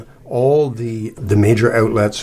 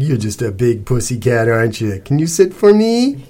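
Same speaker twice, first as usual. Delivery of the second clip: put-on baby the big dog, rising to a request; cooing; 2 semitones higher, 5 dB louder than usual. A narrator's reading. Cece making friends with the dog, cooing.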